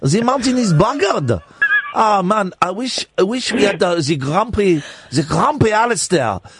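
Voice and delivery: mock-mournful Swiss accent; hammed-up Swiss villager's accent. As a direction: lament in a comic Swiss accent. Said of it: "It's a Swiss accent".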